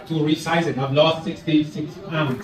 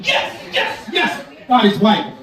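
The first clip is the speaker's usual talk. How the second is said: acted celebration, hissed yeses into a raised voice; jumping for joy; 4 semitones higher, slower than usual. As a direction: exult in bursts, then declare it higher.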